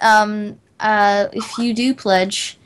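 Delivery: ordinary talk, nothing marked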